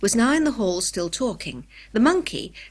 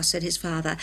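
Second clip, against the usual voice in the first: usual speaking voice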